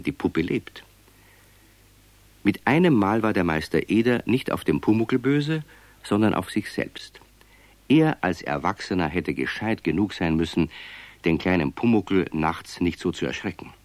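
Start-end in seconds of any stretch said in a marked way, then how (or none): none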